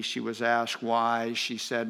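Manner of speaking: ordinary talk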